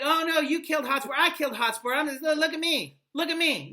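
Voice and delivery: high, loud, fast boastful Falstaff voice; mock braggart. Teacher mocks Falstaff bragging to the class, high and loud. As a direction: hams up a boastful braggart claiming credit.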